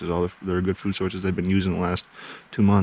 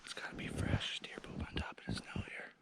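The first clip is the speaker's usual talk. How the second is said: hushed whisper while stalking; 13 semitones higher, 21 dB quieter than usual. Real, not acted. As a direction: whisper so the deer can't hear.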